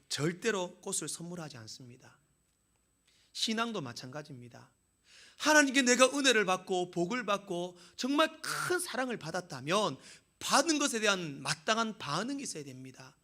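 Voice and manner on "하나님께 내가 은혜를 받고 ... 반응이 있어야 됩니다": loud rising sermon voice; fervent pulpit shout; preaching at full force. Acted. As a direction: preach it out hard to the congregation.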